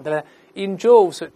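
usual speaking voice

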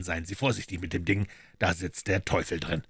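ordinary delivery, nothing marked

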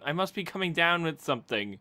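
ordinary delivery

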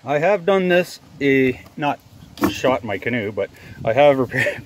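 usual speaking voice

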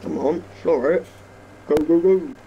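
rising hype, go go go; excited cheering. Real, not acted. Urging tractor.

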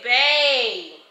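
loud excited call to bae. Real, not acted. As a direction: call "Bae." loudly across the room. Calling partner over to see.

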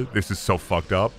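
normal talking voice

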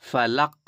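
"ফালাক" with the final q bounced like an echo; echoing qalqala. Shown as a demonstration. Teacher says listen, "ফালাক" with echoed final q. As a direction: stop on "ফালাক", then let its last consonant rebound. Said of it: "প্রতিধ্বনি করে পড়তে হবে".

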